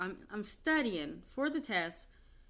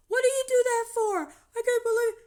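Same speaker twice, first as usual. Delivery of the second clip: much higher, louder wailing mock voice; mocking ex-husband; 12 dB louder than usual. Ex-husband upset his clothes were trashed, high mock wailing. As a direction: wail it like a hurt crybaby.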